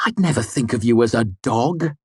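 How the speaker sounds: bright sarcastic sweetness; brittle brightness. A narrator's reading. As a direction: smile through the insult. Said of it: "bright and brittle smile".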